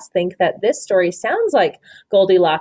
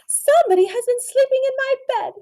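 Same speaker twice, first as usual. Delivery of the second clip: much higher and quicker; high mommy voice; faster than usual. A narrator's reading. Teacher voicing the mommy person finding her bed taken, high and quick. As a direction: squeak it out like a startled mum. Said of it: "said the mommy person".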